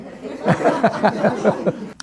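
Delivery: long, high run of laughter; hearty laugh. Real, not acted. Teacher amused by student's attempt, laughing heartily.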